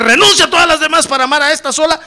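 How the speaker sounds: loud, high, pressing question; insistent pastor. Acted. Pastor pressing the groom to renounce, loud demand.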